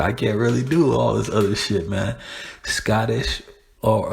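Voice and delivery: ordinary talk, nothing marked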